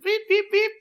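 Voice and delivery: imitating beeping. Guest recreating the beeps he heard again, high mock beeps. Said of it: "I hear it again".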